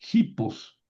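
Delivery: hipos said slowly with a high pitch, as a model word; Greek word hipos. Shown as a demonstration. Teacher models hipos for the class, slow and clear. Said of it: "les pongo aquí el artículo".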